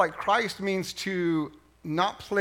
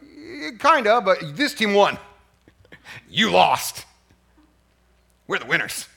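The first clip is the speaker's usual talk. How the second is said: mock gloating dad; 5 dB louder than usual. Dad gloating at his kids.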